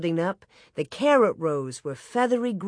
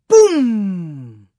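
booming explosion; 10 dB louder than usual. A narrator's reading. Cannon-like blast jolting sleepers awake, booming. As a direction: sound the blast, long and falling.

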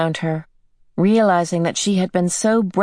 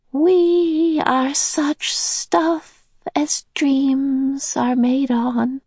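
put-on much higher, very slow old lady voice; high, slow, pleased recitation; Mrs Who smiling; 9 semitones higher, much slower than usual. A narrator's reading. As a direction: savour the quotation, smiling. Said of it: "she smiled broadly".

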